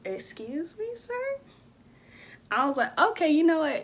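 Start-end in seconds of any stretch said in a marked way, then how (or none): 0.0-1.4 high, softer, affronted question; retold offended politeness; mock incredulous. Retold reply to unhelpful driver. 3.0-3.8 high, loud, fed-up reply; mock losing patience; retold exasperation.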